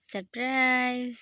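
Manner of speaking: slow, playful surprise reveal; drawn-out surprise. Real, not acted. Revealing a gift to toddler. (টেনে টেনে surprise বলা)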